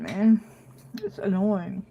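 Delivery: ordinary talk, nothing marked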